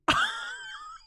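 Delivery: scream-laugh; shrieking laugh. Real, not acted. Shouting laugh.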